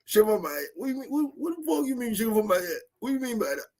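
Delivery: mock outraged disbelief; incredulous high voice. Kat answering the Illuminati on the phone, incredulous. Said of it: "Kat will be like".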